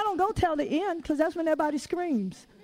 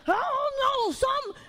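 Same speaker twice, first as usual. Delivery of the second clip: high-pitched mock hollering; retold yelling; 10 semitones higher than usual. Preacher imitates Jeremiah hollering along with her husband, mock yelling. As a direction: imitate a worked-up sports fan, high and loose. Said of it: "Jeremiah was doing the same thing".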